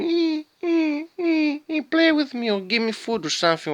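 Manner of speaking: very high squeaky begging whine; high pleading dog whine. Retold or imitated. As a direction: squeal it like a hungry pup begging. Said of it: "started doing".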